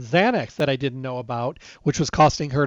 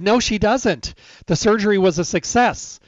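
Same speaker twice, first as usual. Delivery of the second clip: louder, higher, insisting no; firm retort; 6 semitones higher, 5 dB louder than usual. Retold or imitated. Arguing with her mom's doctor, a raised insistent no. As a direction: shut the doctor down hard.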